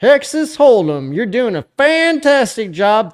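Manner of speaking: very high, loud silly cheer; mock-gushing high-pitched holler; hammed-up praise. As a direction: shout it out in a high put-on voice. Hammed-up praise of a chat commenter.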